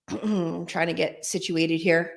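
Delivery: normal talking voice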